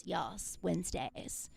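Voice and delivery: breathy, hushed one-word drawl. Retold or imitated. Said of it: "she just goes".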